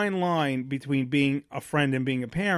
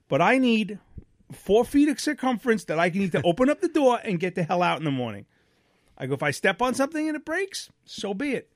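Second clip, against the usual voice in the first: put-on stern lecture to the kids; 6 semitones higher than usual. Laying down the law to kids.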